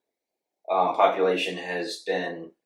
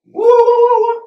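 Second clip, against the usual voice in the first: loud woo whoop; 12 dB louder than usual. Real, not acted. Whooping after accent joke.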